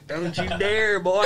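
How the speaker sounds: loud, drawn-out Southern drawl; Southern accent. Pretend. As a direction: ham up a slow Southern accent. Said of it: "It's so funny to do a Southern accent".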